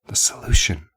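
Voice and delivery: voiceless stage whisper. A narrator's reading. Terry confiding her aim, stage whisper. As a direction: whisper it clearly for effect. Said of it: "she whispered".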